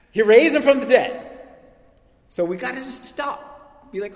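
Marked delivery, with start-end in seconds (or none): 0.1-1.4 much higher and louder proclamation; emphatic shout. Real, not acted. Preacher telling the congregation Jesus raised the dead, shouting. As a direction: hit it like the stunning news it is.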